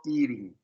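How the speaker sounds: "eating" ends on a soft "in"; ending without G. Shown as a demonstration. Teacher shows the ending of "eating" with no G. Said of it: "Esse in, in, sem o G".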